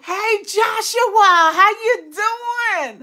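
joyful call to a viewer; excited call. Real, not acted. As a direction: call out to a dear friend. Calls out to viewer fondly.

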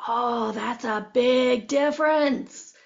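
slow, drawn-out realization; acted-out lightbulb moment, very slow; dawning realization. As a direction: stretch each word as it sinks in.

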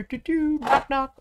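high sing-song walking noises and knocks; toy-play doo-doo, high and light; playful sound effects. Pretend. Showing players at the terrain's door, playful high sound effects.

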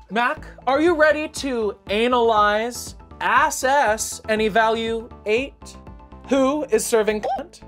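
sassy drag queen. Acted. Show host announcing.

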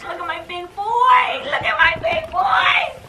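very high, teary, slow coo. Real, not acted. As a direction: gush over the big dog. Praising the dog.

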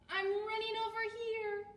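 high, loud character call; Fluff's voice. A narrator's reading. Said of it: "said Fluff".

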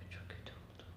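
a hushed, breathless whisper; real whispered dismay. Real, not acted. Dismayed aside about the ruin.

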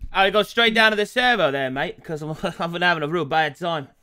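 broad, chatty Australian; Australian accent. Acted. Player showing off an accent from the clinic, Australian accent. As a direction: talk like an Aussie mate. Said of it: "a clinic on the Australian accent".

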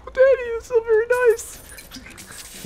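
put-on slow, squeaky falsetto kid voice; very high, loud put-on child; little child impression. Little Billy answering Daddy, squeaky mock-child voice. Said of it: "that was a good impression".

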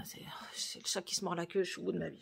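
whispered and weary; real whisper. Real, not acted. Worn out by school-transfer paperwork, whispering to the camera. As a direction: breathe it out, worn down.

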